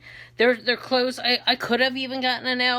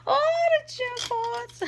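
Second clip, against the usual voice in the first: put-on very high, slow comic accent; put-on Edith Bunker accent; slower than usual. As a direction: stretch it out in a squeaky mock accent. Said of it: "reminded me of how I used to do Archie".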